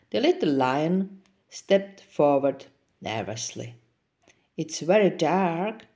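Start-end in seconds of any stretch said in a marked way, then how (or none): none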